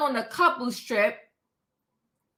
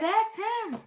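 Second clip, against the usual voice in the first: quoted reply; 7 semitones higher, 7 dB quieter than usual. Retold or imitated. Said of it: "And she said".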